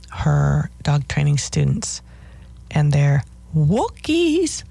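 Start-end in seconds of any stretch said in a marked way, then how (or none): 3.6-4.6 high, drawn-out sing-songy trainer's call; Barbara Woodhouse impression. Dog trainer calling out to her students, sing-songy. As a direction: swoop up high and stretch it out, sing-song. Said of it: "that little sing-songy voice".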